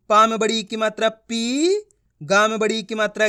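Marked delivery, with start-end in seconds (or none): 1.1-1.8 पी stretched long, rising in pitch. Shown as a demonstration. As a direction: draw out पी on an upward glide. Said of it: "खींच खींच के पढ़ना है".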